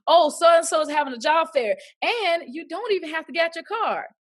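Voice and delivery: light, high-pitched teasing retelling; joking. Retold or imitated. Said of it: "she did it in a joking way".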